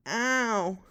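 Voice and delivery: sharp loud ow of pain; pained yelp. Acted. Newcomer yelps after poking own eye. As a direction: yelp as the finger hits the eye.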